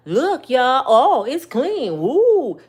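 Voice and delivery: loud, slow, sing-song sarcastic gush; mock Tiffany excitement. Comic imitating Tiffany gushing that it's clean. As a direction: ham up the fake delight. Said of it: "She was like".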